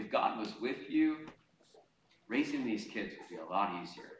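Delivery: soft, sinking voice of the accuser; enemy's quiet insinuation. Acted. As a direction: slip the doubt in quietly. Voices the enemy's discouraging taunt.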